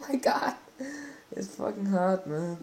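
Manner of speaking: normal talking voice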